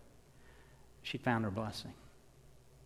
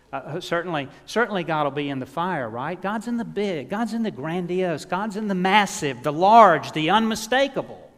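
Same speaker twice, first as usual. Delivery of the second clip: loud, higher, building crescendo; preaching at full force; 4 semitones higher, 10 dB louder than usual. Acted. Preacher building to a climax before the congregation, loud. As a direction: hammer each phrase home.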